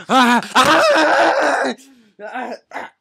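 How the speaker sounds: high, loud panicked screams; screaming. Real, not acted. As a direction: yell as it attacks. Another monkey attacks.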